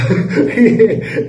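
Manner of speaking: laughing through the words, slow and loud. Real, not acted. Laughing to the viewers after mimicking the friend. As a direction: let the laugh spill over.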